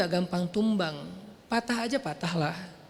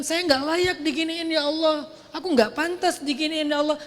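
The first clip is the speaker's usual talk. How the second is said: whiny complaining prayer; 5 semitones higher, 4 dB louder than usual. Retold or imitated. Mocking a believer who whines to Allah in self-pity. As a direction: whine it like a wronged child.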